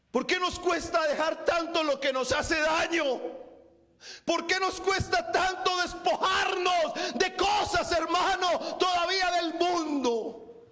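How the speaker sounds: high, impassioned pulpit questioning; fervent preaching. Real, not acted. Preacher challenging brethren to let go of worldly things, fervent preaching. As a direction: press the question on them forcefully.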